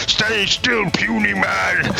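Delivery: high, drawn-out villain taunt; taunting brute enemy. Acted. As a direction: bellow it at a pesky little opponent. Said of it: "He goes".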